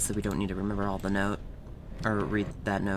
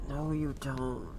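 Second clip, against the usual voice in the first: ordinary delivery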